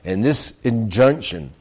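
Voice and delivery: usual speaking voice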